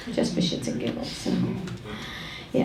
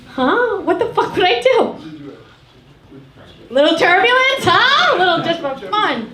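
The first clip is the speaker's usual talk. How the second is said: mean pilot; 7 semitones higher, 3 dB louder than usual. Pretend. Grumpy pilot imagined.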